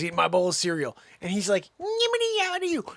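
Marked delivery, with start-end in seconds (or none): 1.8-2.9 high-pitched nonsense imitation; mock gibberish jabber. Imitating someone going off during breakfast, high mock gibberish.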